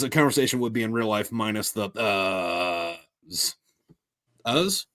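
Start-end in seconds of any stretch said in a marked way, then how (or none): 1.9-3.5 "uhs" stretched out long; "uhs" as one long held vowel; drawn-out filler. Shown as a demonstration. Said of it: "is that how you pronounce it". 4.5-4.9 "Uhs" lifted high as a question; "Uhs" said high, checking the word; questioning pronunciation. Shown as a demonstration. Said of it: "is that how you pronounce it".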